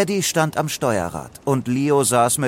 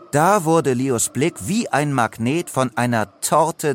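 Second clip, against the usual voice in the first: usual speaking voice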